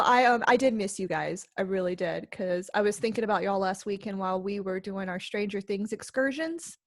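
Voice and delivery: ordinary delivery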